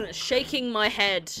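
slow, loud, drawn-out mock mourning; mock solemn. Repeating the friend's slow line as mock-solemn mourning. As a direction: spell out the head shake with fake gravity.

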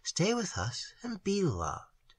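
slow, soft, lingering on loved; soft tender plea. A narrator's reading. Maiden softly pleading with the stranger to stay. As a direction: ask so softly he can't refuse. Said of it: "looked into it tenderly".

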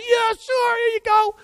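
high, breezy friend voice; Marcus agreeing. Retold or imitated. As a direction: toss it off like an easygoing kid.

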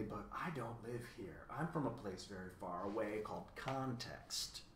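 soft, wistful longing. A narrator's reading. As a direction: speak softly, longing for a faraway home. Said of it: "he said longingly".

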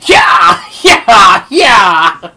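repeated loud, high yelps; play battle yells. Pretend. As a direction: burst out in short whooping shouts. Playful battle yells in game.

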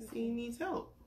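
usual speaking voice

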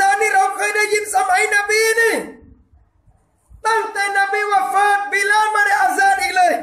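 high, loud shouted disbelief; crowd crying out. Retold or imitated. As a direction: shout it like the townsfolk rushing out. People of Madinah crying out. (ตะโกนเสียงสูงอย่างชาวเมืองที่ตกใจ)

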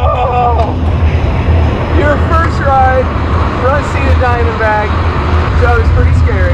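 loud, breathless shouting; shouting over noise. Real, not acted. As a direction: yell over the wind. Shouting over ride noise after.

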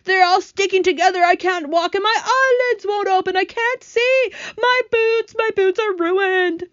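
loud, very high outraged wailing; angry screaming. A narrator's reading. Centipede covered in sticky paint, screaming with anger. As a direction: rage and wail at the top of the range. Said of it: "The centipede screamed with anger".

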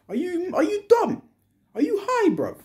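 can't believe anyone would say that, voice shoots up; incredulous outburst. Real, not acted. Fan scolding fans who want Gabriel sold, high disbelieving yelp.

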